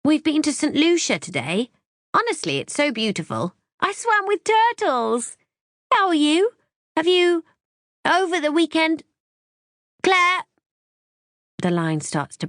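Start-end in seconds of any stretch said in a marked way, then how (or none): 0.0-10.4 much higher, louder, gushing mother; a breezy mum calling from holiday; high cheerful mother on the phone; Mum's voice. Acted.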